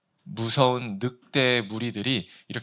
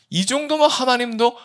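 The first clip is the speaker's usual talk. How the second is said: high, loud self-justifying protest; insistent claim; 10 semitones higher, 6 dB louder than usual. Acted. Preacher voicing believers arguing about God, insistent protest. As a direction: insist that you are owed approval. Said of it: "주장을 해요".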